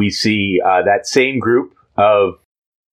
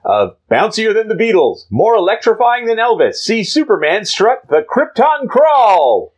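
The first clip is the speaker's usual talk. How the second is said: announcer voice; 12 semitones higher than usual. Acted. Reading the inset title.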